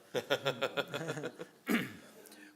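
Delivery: warm trailing laugh. Real, not acted. Son laughing after telling the audition story, a trailing laugh. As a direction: let the laugh roll out.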